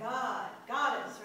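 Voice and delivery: "God" hit hard and loud, then "Goddess" with a strong first syllable; stress on first syllable. Shown as a demonstration. Teacher confirming the harder syllable, loud stress on "God" in "Goddess". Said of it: "Which one do you say harder".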